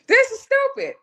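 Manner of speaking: sharp high burst of disbelief; exasperated scoff. Retold or imitated. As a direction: brush it off as ridiculous. Scoffing at the inner voice.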